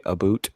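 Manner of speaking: ABOUT said as "a boot"; Canadian "about". Retold or imitated. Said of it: "Canadian people say".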